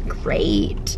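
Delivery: whispered dry "great"; sarcastic whisper. Real, not acted. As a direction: breathe it out, deadpan. Sarcastic aside about needing gas.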